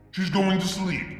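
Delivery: usual speaking voice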